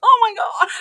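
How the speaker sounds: high, loud gasp of shock; stunned oh my God. Retold or imitated. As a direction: blurt it out after getting smacked. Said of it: "And I'm like".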